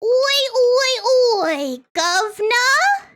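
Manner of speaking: drawn-out cartoon British call; mock British accent. Streamer announces the British voice curse, mock British accent. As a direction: hail someone in hammed-up British.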